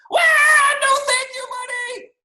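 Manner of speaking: high, loud yell of alarm; loud, high shocked refusal; totally surprised. A narrator's reading. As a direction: shriek it up high, startled. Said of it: "he's totally surprised".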